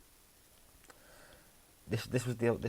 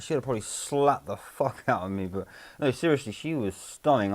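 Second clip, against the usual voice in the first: normal talking voice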